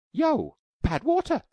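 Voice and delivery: very high, loud excited shout; a girl cracking her father's picture code; excited cry. Acted. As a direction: squeal with discovery. Taffy's excited discovery.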